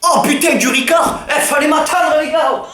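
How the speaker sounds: excited shout. Acted. Friend spotting the Ricard, shouting in laughing glee. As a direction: squeal with joy at the sight of the drink.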